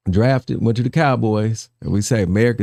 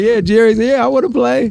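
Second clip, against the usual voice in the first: high, louder teasing imitation; mock eagerness; 12 semitones higher, 5 dB louder than usual. Host teasing the player about saying yes, mock eager. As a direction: playfully imitate an overeager recruit.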